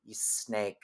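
quiet insult. Retold or imitated. Tess catching the boyfriend cheating, soft muttered insult. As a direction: let it drop almost inaudibly. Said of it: "a very quiet performance".

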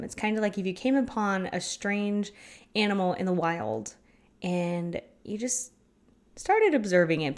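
ordinary delivery

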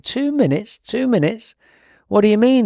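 he can't believe it's that small; incredulous. Retold or imitated. Newcomer to sponsor about meditation, high incredulous voice. Disbelief at tiny task.

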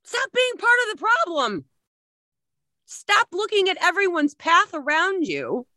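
high, forceful command; commanding shout. Real, not acted. Speaker telling audience off, a forceful shout. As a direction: order them to stop, hard.

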